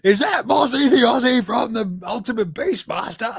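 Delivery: put-on high-pitched excited kid, shouting the question. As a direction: play his little son yelling in disbelief. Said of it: "And he's like".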